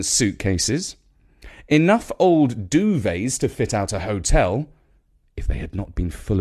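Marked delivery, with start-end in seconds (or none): none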